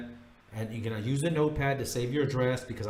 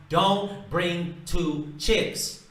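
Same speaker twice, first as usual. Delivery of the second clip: Don't bring two chicks, each word dragged out and punched; louder, drawn-out warning; slow emphatic warning; 4 semitones higher, 6 dB louder, much slower than usual. Real, not acted. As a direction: hammer home the key rule slowly. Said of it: "I saved the best tip for last".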